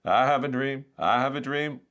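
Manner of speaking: high, loud oratorical refrain; Martin Luther King. Acted. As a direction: ring it out like a famous speech. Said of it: "the wonderful Martin Luther King".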